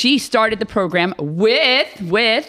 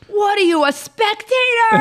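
mock yell from stands; 11 semitones higher than usual. Parent yelling at daughter from the stands, mock holler. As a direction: imitate a parent hollering at their kid. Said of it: "all you hear from the stands is".

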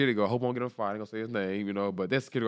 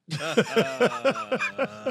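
loud, high-pitched burst of laughter; 13 semitones higher, 4 dB louder than usual. Real, not acted. Storyteller recalling the camera surprise, high laugh. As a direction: laugh out high for a couple of seconds. Retelling his shock at being filmed.

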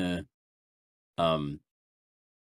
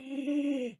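sharp high gasp of delight; excited gasp; 13 semitones higher, 8 dB quieter than usual. Real, not acted. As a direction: gasp in thrilled surprise. Reacting to gun plan.

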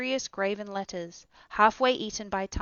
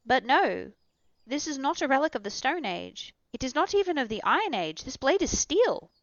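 higher, building excitement to steel; excited Axel; 5 semitones higher, 5 dB louder than usual. A narrator's reading. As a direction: get carried away with wonder. Axel's rising excitement.

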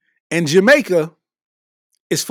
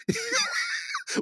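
shrieking laugh; 12 dB quieter than usual. Real, not acted. Huge laugh at own joke.

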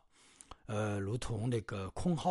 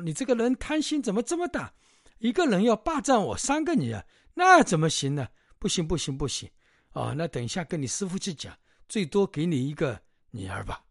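much higher, louder indignant outburst; indignant refusal; 7 semitones higher, 8 dB louder than usual. Retold or imitated. Hostess scolding Bajie for wanting all three daughters, indignant loud. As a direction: scold his greed, loud and shocked.

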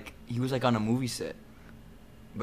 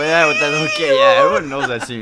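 laughing; 6 semitones higher, 11 dB louder than usual. Real, not acted. Laughing at Mr Bean scene.

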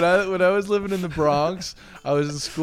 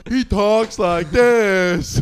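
very slow, louder Forrest Gump drawl; Forrest Gump voice as a kid; 2 semitones higher, 7 dB louder, much slower than usual. Retold or imitated. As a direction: stretch every word out like Forrest Gump. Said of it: "He goes like".

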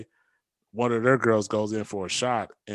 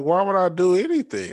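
mock bravado; 10 semitones higher, 8 dB louder than usual. Retelling banter with a teammate.

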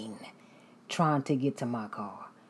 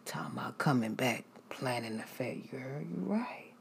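awkward hushed agreement; 8 dB quieter than usual. Retold or imitated. Her own awkward reply.

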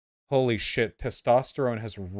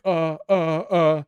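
stunned stammer; 6 semitones higher, 6 dB louder, slower than usual. Retold or imitated. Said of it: "I'm like".

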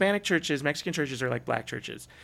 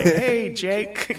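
high, loud teasing hello; mock greeting; 9 semitones higher, 6 dB louder than usual. Friend teasing him with his wrong name, high and loud.

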